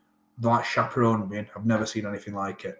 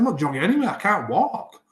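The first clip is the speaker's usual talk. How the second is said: high, loud, emphatic no; indignant refusal; 7 semitones higher, 3 dB louder than usual. Retold or imitated. To the physio, a raised, indignant refusal. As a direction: shoot down the physio's plan with disbelief.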